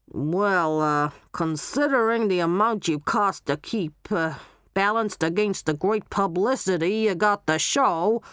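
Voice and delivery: Barry weighing it up in a put-on voice; Barry the showman. Barry naming Molly's wages, put-on showman voice.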